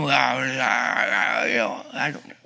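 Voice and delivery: low, mumbled put-on voice; disguised Esau voice. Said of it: "he probably talking like this".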